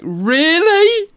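high, loud rising disbelief; incredulous reaction. Retold or imitated. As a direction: stretch it into an appalled question. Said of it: "I'm like".

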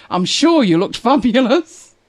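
drawn-out mock praise; teasing. Real, not acted. Teasing [name] about her [name] look, playful.

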